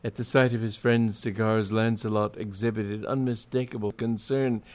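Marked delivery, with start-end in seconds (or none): none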